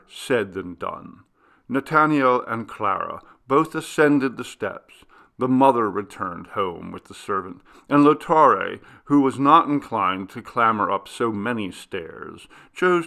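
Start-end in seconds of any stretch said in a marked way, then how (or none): none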